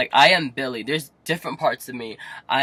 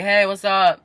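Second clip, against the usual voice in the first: higher, louder chirped hello; cheery greeting; 6 semitones higher, 8 dB louder than usual. Retold or imitated. Greeting family waiting at home, cheery. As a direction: act out breezing in unaware.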